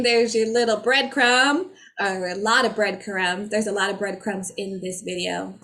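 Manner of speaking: ordinary talk, nothing marked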